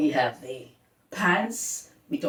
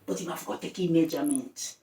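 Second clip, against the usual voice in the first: ordinary talk, nothing marked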